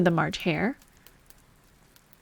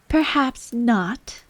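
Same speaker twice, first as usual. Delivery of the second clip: slow, careful and hesitant; cautious; 4 semitones higher, much slower than usual. A narrator's reading. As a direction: answer slowly, unsure of yourself. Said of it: "Alice cautiously replied".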